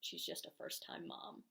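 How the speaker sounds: whispered, dismissive sneer; hushed condescending remark; dismissive eye-roll. Retold or imitated. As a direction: say it like a catty aside, under your breath. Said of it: "roll their eyes and say".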